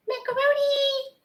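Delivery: high, slow sing-song call to cat. Real, not acted. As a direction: call the cat from across the room. Calling the cat by name.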